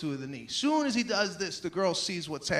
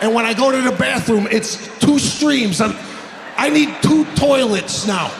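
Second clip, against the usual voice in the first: full-volume ranting delivery; loud comic rant; 11 dB louder than usual. Real, not acted. Comic rants to the crowd about bad sleep, loud. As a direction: blast it at the crowd.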